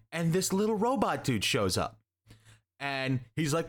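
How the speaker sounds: ordinary talk, nothing marked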